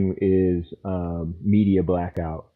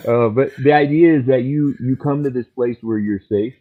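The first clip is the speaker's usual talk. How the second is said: usual speaking voice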